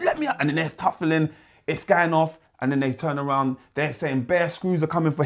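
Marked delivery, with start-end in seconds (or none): 0.0-0.4 high, pleading mock cry to be let out; mock plea.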